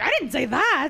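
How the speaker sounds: high, incredulous protest; mock-horrified denial, voice jumping up; indignant denial. Replaying her denial of a misheard slur, indignant protest.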